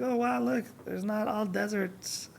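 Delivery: high, slow, soft amazed gasp; mock amazement. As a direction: play a dazzled tourist.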